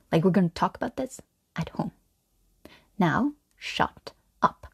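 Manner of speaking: stern whispered shushing, with long pauses; low, secretive scolding half-whisper; hushed silencing. Acted. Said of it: "something about, like, silencing it".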